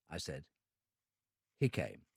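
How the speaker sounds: plain reading voice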